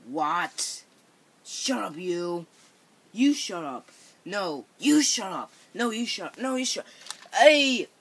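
ordinary delivery

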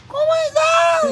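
very high, loud squeaky mimicry; mock a big man's ridiculously little voice; mock tiny voice. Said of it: "Y que me sale con su vocito". (vocecita aguda de burla)

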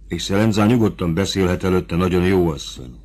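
ordinary delivery

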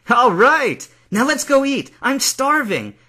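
high, loud, elated whoop; jubilant cheer. Acted. Hungry champion learns he eats free, jubilant. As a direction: shout it out with glee.

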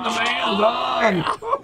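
drawn-out slow shout, pitched high; excited shout. Real, not acted. Shouting to the group that Dan has a fish on, drawn out. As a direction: call it out across the boat so he hears. Friends cheering a hooked fish.

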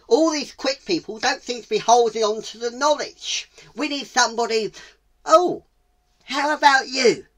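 loud, high owl voice; exasperated owl. Acted. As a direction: complain to the gathered animals. Owl exasperated, picks tortoise.

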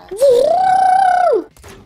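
very high, loud delighted gasp; big gasp. Real, not acted. Gamer spotting the stormtrooper, a loud thrilled gasp. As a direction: gasp as if seeing a treasure. Sees a new level.

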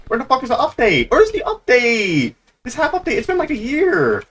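high, whining complaint; exasperated demand. Real, not acted. Gamer complaining the update is late, exasperated demand. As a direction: push the voice way up in exasperation.

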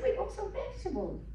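high, pleading request. Retold or imitated. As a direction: beg sweetly for vegetables. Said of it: "I said".

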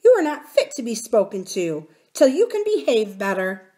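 a little royal rebuking rudeness; offended princess. A narrator's reading. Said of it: "that's very rude".

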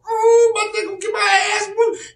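high-pitched mock wail; squealing, drawn-out comic wail; wailing kid getting whooped. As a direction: cry out like a child being whooped. Imagines getting whooped as a child.

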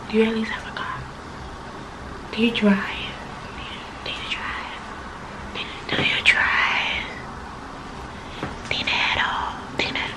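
whispered teasing questions; soft teasing whisper; mock whisper. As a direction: hush it like a sly aside.

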